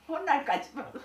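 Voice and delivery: high, loud words through laughter; laughing words. Real, not acted. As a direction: talk through a burst of laughter. 웃음 사이에 이어 말함. (웃음 섞인 높고 큰 목소리)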